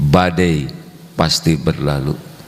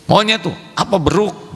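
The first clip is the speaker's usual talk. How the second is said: mock retort; 12 semitones higher than usual. Voicing a retort that starts fight.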